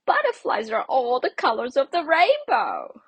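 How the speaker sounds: high, loud, snooty dragonfly; a lofty, showy insect voice; bright dragonfly. A narrator's reading. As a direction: look down on the dull caterpillar. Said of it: "said a bright dragonfly".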